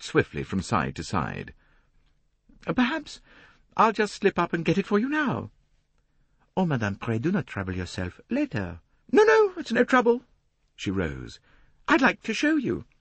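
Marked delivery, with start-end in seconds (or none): 2.6-5.5 much higher, a self-important lady complaining; a fussy society matron, preening. A narrator's reading. Mrs Otterbourne voiced by the narrator. 6.6-8.8 a polite Belgian detective asking; polite French accent. A narrator's reading. Poirot voiced by the narrator. 9.1-12.9 much higher, a self-important lady complaining; a fussy society matron, preening. A narrator's reading. Mrs Otterbourne voiced by the narrator.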